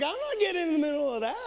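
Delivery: giggling refusal to step in; laughing quote. Retold or imitated. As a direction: rush it out mid-laugh. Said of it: "I'm like".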